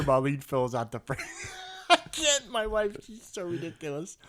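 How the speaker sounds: real laughter breaking up the words, high-pitched; helpless giggling through the telling; laughing while talking. Real, not acted. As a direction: barely get the story out for laughing. Cracking up while telling wife story.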